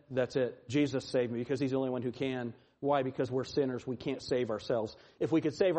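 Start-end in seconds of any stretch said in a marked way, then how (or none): none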